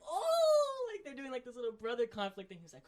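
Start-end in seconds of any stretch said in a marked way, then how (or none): 0.1-0.9 excited oh. Retold or imitated. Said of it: "And I was like". 0.9-2.6 hyped. Real, not acted. Hyped about the brother conflict.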